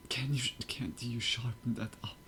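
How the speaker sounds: whispering. Real, not acted. Muttered question while watching.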